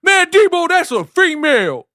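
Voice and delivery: very high, loud squeaky protest; imitating Craig's high pleading voice. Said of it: "And Craig".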